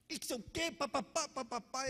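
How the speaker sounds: high-pitched imitation of bickering; mock bickering. Mimics the couple's bickering.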